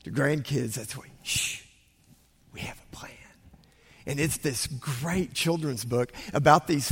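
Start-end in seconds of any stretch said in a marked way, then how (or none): 1.2-3.1 hushed secret whisper. Retold or imitated. Grandpa reading the book aloud to grandkids, whispering. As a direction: hush them and whisper the plan. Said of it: "whenever I got to read it to grandkids".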